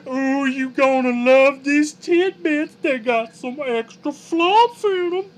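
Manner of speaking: high, loud, slow southern drawl with an effeminate lilt; Flump voice. Acted. Streamer shows chat his Flump voice for D and D, high camp southern drawl. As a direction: camp monster host drawling a welcome. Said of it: "kinda had a southern drawl".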